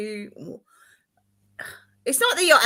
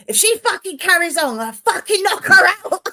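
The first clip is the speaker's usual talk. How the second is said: high, loud furious threat; mock rage at the midwife; retold angry threat; 9 semitones higher, 9 dB louder than usual. Mother retelling threatening the midwife in labor, loud and angry. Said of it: "I was like".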